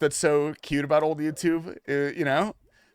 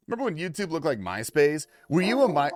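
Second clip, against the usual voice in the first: usual speaking voice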